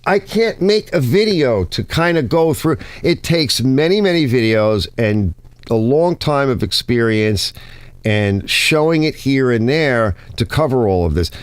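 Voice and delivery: usual speaking voice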